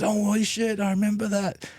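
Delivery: high, amazed recall of the moment; surprised. Retold or imitated. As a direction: voice jumps up as if suddenly remembering. Acting out Muron's surprised reply.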